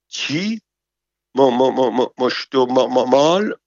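high startled "chi?" then broken stammering; shocked stammer. Acted. Shocked servant stammering at the lady's order, stuttering. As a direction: stutter in panic at the shocking request.

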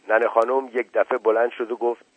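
ordinary delivery, nothing marked

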